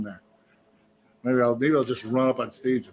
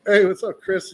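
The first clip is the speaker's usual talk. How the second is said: high, loud hello; retold eager greeting; 7 semitones higher, 7 dB louder than usual. Storyteller hailing the singer who dislikes him, eager greeting. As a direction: call out a breezy greeting, pitched up.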